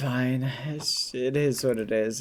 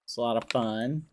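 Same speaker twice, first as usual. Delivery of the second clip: usual speaking voice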